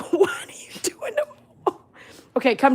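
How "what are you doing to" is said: high, squeaky question lost in laughter; helpless laughter. Real, not acted. Owner asking the dogs what they're doing, cracking up. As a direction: wheeze it out while laughing.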